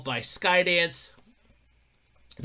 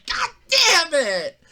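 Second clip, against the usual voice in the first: frustrated yell; 11 semitones higher, 4 dB louder, much slower than usual. Retold or imitated. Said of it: "And I was like".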